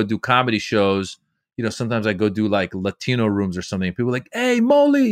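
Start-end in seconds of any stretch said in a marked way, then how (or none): 4.3-5.1 high-pitched, drawn-out mock holler; imitated fan call. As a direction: call out the name like an excited fan. Fans calling out to him.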